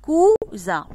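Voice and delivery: COUSIN in two loud beats, cou, zin; syllable by syllable. Shown as a demonstration. Teacher asks pupils to listen, splitting cousin loudly. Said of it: "Voilà, écoutez".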